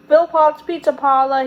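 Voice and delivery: put-on takeout patter; joke pizza-parlor greeting. A narrator's reading. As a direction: answer the phone like a pizza joint. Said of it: "didn't really run a pizza parlor".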